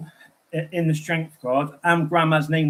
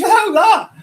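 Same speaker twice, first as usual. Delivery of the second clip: very high, louder squeal of shock; imitating the crystal shop lady's amazed shriek; 9 dB louder than usual. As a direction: squeak it out like she's seen a ghost. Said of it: "She goes".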